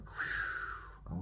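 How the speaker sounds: long voiceless breath out; exhaled whew. Retold or imitated. Speaker reacting to friend's account, re-enacted exhaled whew. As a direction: blow out a long, airy whew.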